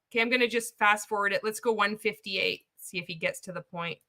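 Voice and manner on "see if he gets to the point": usual speaking voice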